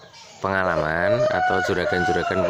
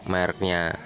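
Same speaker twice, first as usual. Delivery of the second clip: usual speaking voice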